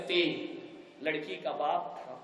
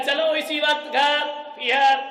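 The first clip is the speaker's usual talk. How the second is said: angry demand; 8 semitones higher, 8 dB louder than usual. Acted. Daughter demands to go home.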